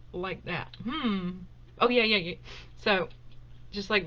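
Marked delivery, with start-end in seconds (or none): none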